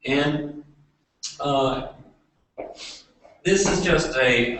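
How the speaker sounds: usual speaking voice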